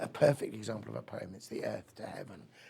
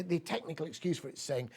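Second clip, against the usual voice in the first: ordinary delivery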